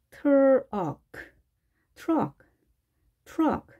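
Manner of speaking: 트럭 as English truck, T and R fused. Shown as a demonstration. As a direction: blend the start of 트럭 towards "ch", curled R. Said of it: "T와 R이 융화된 듯한 느낌".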